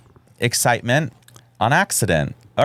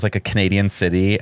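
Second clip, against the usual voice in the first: ordinary talk, nothing marked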